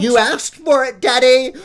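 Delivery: high, loud menacing cry; threatening shout. Acted. Daughter threatening her daddy, high furious warning.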